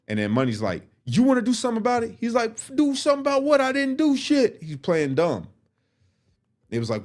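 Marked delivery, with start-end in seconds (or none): 1.1-4.6 high, loud challenge and retort; heated standoff. Retold or imitated. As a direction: push back hard and play dumb. Money challenges, Dallas plays dumb.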